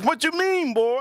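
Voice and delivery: high-pitched mock woman's retort; stripper imitation. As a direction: imitate her in a high falsetto. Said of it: "She goes".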